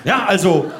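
ordinary talk, nothing marked

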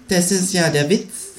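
high, slow cartoon animal voice; kangaroo character. A narrator's reading. Kangaroo answering the dazzled narrator, high and slow. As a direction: drag it out in a high creature voice.

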